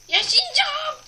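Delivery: sudden high, loud cry of resolve; yell. Real, not acted. Gamer yelling at the screen he will survive. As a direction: belt it out, refusing to give up.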